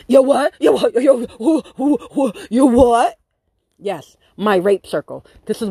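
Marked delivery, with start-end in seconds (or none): 0.1-3.2 high, loud stunned stammer; shocked yo what. Retold or imitated. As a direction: sputter in disbelief. Owner shocked, retold.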